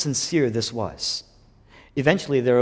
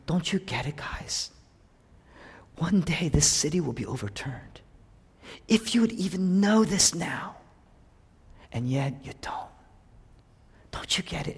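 pleading lament; 3 semitones higher, 4 dB quieter than usual. Acted. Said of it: "He's saying".

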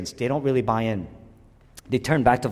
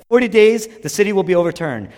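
higher, louder proclamation of doom; Jonah's warning; 6 semitones higher, 8 dB louder than usual. Acted. Jonah warning the city of judgment, shouting. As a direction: cry it out to the city. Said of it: "Jonah said".

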